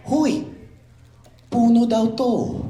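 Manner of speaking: high, slow squeaky Ewok voice; Ewok speaking Tagalog. Retold or imitated. Ewok spotting the tree, squeaky and high. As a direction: chirp it like a little Ewok. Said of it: "They say".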